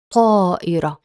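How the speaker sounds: طائرة with a heavy emphatic ط and a long ā; Arabic word demo. Shown as a demonstration. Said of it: "Here are some examples".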